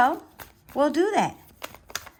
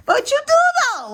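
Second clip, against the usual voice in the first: playful insistence; 7 dB louder, faster than usual. Real, not acted.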